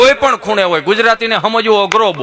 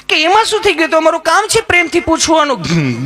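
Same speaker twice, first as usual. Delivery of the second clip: much higher, gently coaxing; sweet high nurse; 7 semitones higher than usual. Retold or imitated. Nurse coaxes nervous Gabho in a high sweet voice. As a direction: soothe a nervous patient. Nurse asks why he's afraid.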